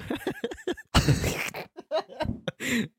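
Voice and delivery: long high giggle; high laugh. Real, not acted. Laughing at friend's stumble.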